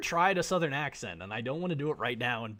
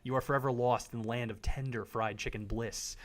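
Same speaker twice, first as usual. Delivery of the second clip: ordinary talk, nothing marked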